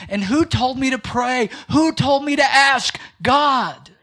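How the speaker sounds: preacher building to a shout; building preaching. Acted. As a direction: build to the answer "God". Rising preaching climax.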